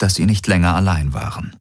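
ordinary delivery, nothing marked

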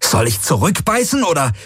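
high, exasperated retort; angry desperation. A narrator's reading. Pilot snaps back at crewmate, angry and desperate. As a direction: throw it back in frustration. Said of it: "ohne seine eigene Wut oder Verzweiflung zu verhehlen".

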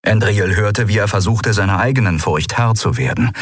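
usual speaking voice

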